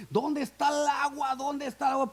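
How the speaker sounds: high-pitched call of a thirsty deer; acted deer's cry, pitched high. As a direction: call out urgently for water. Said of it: "Y empieza a gritar".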